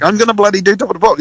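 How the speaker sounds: mock-proud announcement in a rush. Young musician finally getting Top of the Pops, proudly. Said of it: "you're pretty proud".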